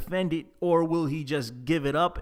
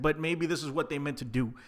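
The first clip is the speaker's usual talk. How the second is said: ordinary talk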